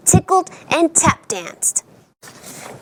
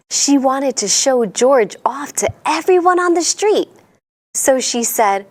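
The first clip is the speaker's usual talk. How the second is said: ordinary delivery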